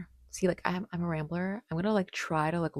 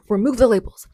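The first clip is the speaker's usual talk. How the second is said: sudden loud declaration, raised pitch; a burst of conviction; loud emphatic; 6 semitones higher, 14 dB louder than usual. Real, not acted. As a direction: punch it out with force.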